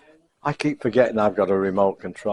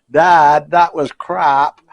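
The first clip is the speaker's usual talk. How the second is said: lightly voiced son, louder and higher; son's voice; 5 semitones higher, 5 dB louder, much slower than usual. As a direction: play the grumbling son, higher.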